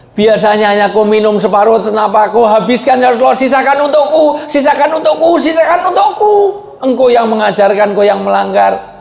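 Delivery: high, rising cry of protest; wife's protesting shout. Retold or imitated. Wife protesting to the Prophet over the emptied glass, shouting. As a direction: plead loudly, pitch climbing with each repeat. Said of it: "teriak-teriak".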